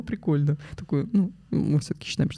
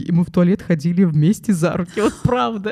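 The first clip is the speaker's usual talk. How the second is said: ordinary talk, nothing marked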